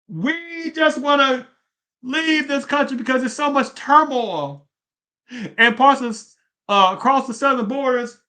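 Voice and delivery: high-pitched, drawn-out mock plea; imagined American citizen. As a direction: voice a hypothetical citizen, pitched well up. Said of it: "What if American citizen says".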